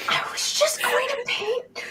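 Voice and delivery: put-on high, slow, strained child voice; flustered kid. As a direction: squeak out an excuse as a kid. Kid makes a flustered excuse.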